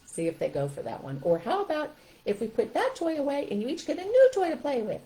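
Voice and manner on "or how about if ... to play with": high puppet voice offering ideas; high-pitched animal helper; stuffed animal voice. Shown as a demonstration. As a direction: suggest it as a toy animal, pitched up. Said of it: "kind of guide them through it through the animal".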